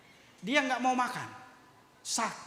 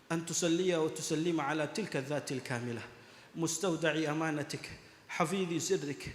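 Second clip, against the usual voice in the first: lower, measured Arabic prayer chant; prayer recitation; 9 semitones lower, 4 dB quieter than usual. Acted. Preacher leads the congregation in tawassul, recited lower and devoutly. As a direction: intone the prayer devoutly to the gathering.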